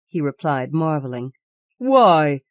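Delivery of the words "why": sharp loud cry; wife crying out at her husband. A narrator's reading. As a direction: fling the question out loud. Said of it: "she cried".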